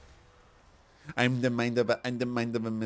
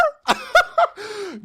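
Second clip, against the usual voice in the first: high, loud burst of laughter; shrieking laugh; 10 dB louder than usual. Real, not acted. Vlogger cracking up at friend's Berlin idea, shrieking laugh. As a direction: cackle helplessly.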